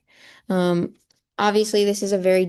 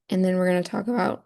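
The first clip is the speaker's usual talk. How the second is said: ordinary talk, nothing marked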